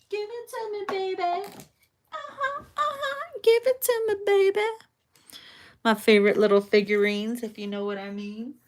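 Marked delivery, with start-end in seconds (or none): 0.1-1.2 much higher, soft, breathy-free squeaky tease; mock seductive. Reader playfully pretending to be the eager lover, high soft tease. 2.1-3.4 very high playful uh-huhs; mock seductive cooing. Reader hamming up the lover's reaction, high playful cooing. 3.4-4.7 much higher and full-voiced, bold come-on; hammed-up seductive. Reader hamming up the lover's demand, high and bold. Mock seductive, louder repeat.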